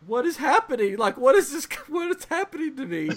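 high-pitched imitation of a baffled child; hammed-up, high toddler bewilderment; bewildered toddler. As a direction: play a little kid shocked by his own body. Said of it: "he looked up at me like".

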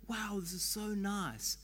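slow, lightly put-on admiration; mock admiring. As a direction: imitate someone pleasantly impressed, slowly. Mimics readers gushing over scripture.

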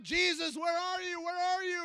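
high, loud shout for Jesus; crying out. Acted. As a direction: call out desperately for help. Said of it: "he's crying out".

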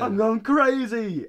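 high, loud, drawn-out cry; mock unhinged yell. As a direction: stretch it out in a hammed-up breakdown. Acting out frantic voicemail apology.

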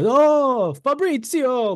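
high, slow mock Italian old man; a cartoonish old Italian man calling out; old Italian guy. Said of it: "here I go with the".

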